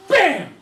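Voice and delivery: sudden high, explosive shout; loud impact "bam". Retold or imitated. Brother punches sister at dinner, explosive shouted impact. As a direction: shout the punch as a sound effect.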